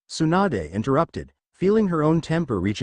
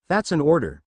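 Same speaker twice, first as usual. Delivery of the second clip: usual speaking voice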